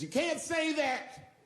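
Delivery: high, slow, drawn-out plea; hammed-up upset woman, high and slow; mock crying woman. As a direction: imitate her tearful objection. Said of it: "She says".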